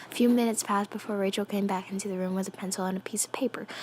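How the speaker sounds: rushed, hushed read-through; racing through the sentence almost whispered; reading too quick. Real, not acted. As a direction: hurry through the line under your breath. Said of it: "I'm reading that a little too quick".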